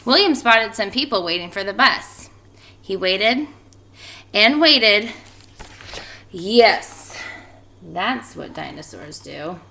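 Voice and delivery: lively storybook reading voice; expressive read-aloud for a class; reading to children. A narrator's reading. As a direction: read it out like story time for kids. Teacher reads picture book aloud.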